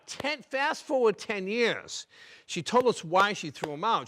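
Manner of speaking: ordinary delivery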